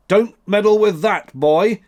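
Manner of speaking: sharp high, loud warning; severe. A narrator's reading. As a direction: stop the boy cold, loud and high. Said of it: "said he in a severe tone".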